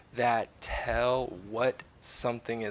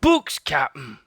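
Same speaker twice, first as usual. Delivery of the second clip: louder call down from the treehouse; pirate calling down; 4 semitones higher, 9 dB louder than usual. A narrator's reading. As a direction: shout it down from above. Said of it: "Pinky shouted down".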